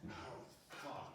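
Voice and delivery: breathless, soft dismayed whisper; a barely voiced curse under the breath; whispered oh fuck. Dread at dad's remark.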